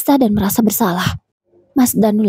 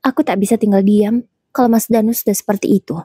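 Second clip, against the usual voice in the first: usual speaking voice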